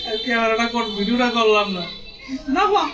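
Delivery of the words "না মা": high squeal. Real, not acted. Sudden cry in the scene.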